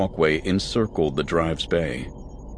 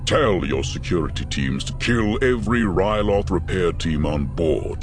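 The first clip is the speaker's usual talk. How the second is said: cold Vader command; 4 semitones lower than usual. A narrator's reading. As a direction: order the killing without emotion. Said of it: "he said, silencing the bridge crew".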